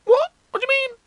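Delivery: high gasp then alarmed question; panicked gasp. Retold or imitated. A kid hears the world ends today, panicking.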